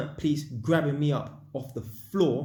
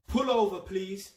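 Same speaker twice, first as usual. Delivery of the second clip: higher-pitched officer's command; mock police order; 6 semitones higher, 3 dB louder than usual. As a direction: imitate a policeman pulling someone over. Acts the police ordering him over.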